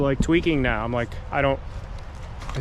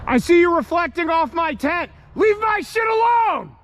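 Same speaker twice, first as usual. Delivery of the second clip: shouting; 14 semitones higher, 4 dB louder, slower than usual. Real, not acted. Yelling at figures by tent.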